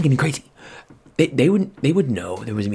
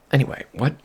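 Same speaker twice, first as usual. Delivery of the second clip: normal talking voice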